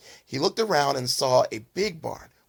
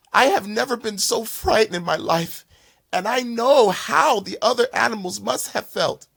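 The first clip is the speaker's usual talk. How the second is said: frightened confession; 7 semitones higher, 4 dB louder than usual. Acted.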